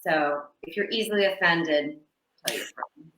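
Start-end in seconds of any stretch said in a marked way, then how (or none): 2.4-3.1 hushed, breathy aside; whisper. Pretend. Host warns offended listeners in a conspiratorial whisper. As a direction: whisper it like a sly secret.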